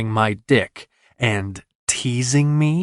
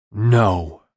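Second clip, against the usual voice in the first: louder guttural no; 4 dB louder than usual. Acted. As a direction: push it out from the throat. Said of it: "came out as a guttural sound".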